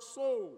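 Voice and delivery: preacher pressing the word "so"; stress on SO. Shown as a demonstration. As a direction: punch the word "so". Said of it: "being emphasized then".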